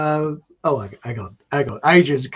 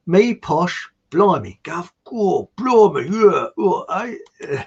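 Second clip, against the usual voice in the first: a hint of a hammed-up Cockney geezer, a bit higher; mock Cockney; 4 semitones higher, 4 dB louder, slower than usual. Host reading out a viewer's cheeky comment in mock Cockney. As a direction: play the cheeky London geezer, lightly.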